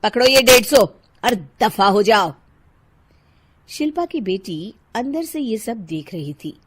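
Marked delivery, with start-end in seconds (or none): 0.0-2.3 loud, curt send-off; angry dismissal. Acted. Housewife sending the vendor away, loud and angry. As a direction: get rid of him brusquely.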